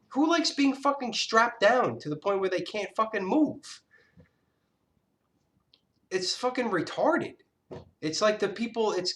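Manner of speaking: ordinary talk, nothing marked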